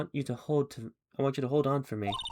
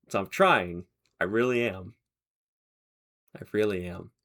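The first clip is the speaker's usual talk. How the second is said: ordinary talk, nothing marked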